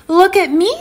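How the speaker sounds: high-pitched little kitty showing off; proud, high kitten voice; eager kitty. Acted. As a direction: call out for attention in a high voice. Proud kitty shows herself off.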